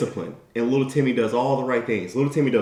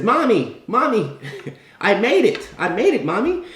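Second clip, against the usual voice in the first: high, childlike mock-excited voice; a kid bursting with pride to mom; excited child; 7 semitones higher than usual. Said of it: "goes to his parents and says".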